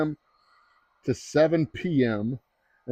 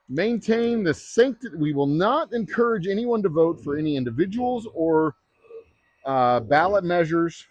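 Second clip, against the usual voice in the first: a half-mocking solemn oath, higher and slow; 5 semitones higher than usual. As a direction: recite the clerk's oath with light mock solemnity. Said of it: "our oath of office as election clerks".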